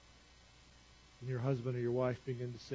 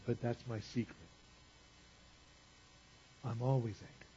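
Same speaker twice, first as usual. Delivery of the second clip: lower, softer movie-hero line with a long pause; understated confession; 4 semitones lower, 7 dB quieter than usual. Acted. Hero confiding his secret before battle, understated confession. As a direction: let the secret out quietly, pausing midway. Acts Hulk's quiet confession.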